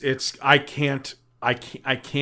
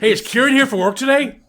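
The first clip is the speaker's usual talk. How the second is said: high, loud, quick coworker call; 10 semitones higher, 6 dB louder, faster than usual. Retold or imitated. Man voicing coworkers who cannot see him, high and loud. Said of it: "acting coworkers at work".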